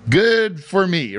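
ordinary delivery

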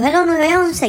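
high-pitched, urgent phone greeting; retold emergency call. Caller acts out phoning nine one one, high mock urgency. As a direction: pitch it up, imitating a frantic caller. Retells calling emergency services.

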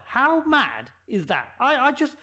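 ordinary talk

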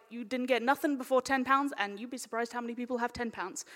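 ordinary delivery

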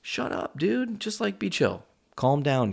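high exasperated pleading to the cat; shushing the cat. Retold or imitated. Owner begging his meowing cat to be chill, high pleading. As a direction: beg the cat to hush, high. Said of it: "And I'm like".

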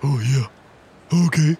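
talking cartoon dog voice; Scooby-Doo. Finding a voice for Omen the dog, Scooby-Doo impression. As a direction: turn the words into doggy noises. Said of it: "He's just gonna be Scooby-Doo".

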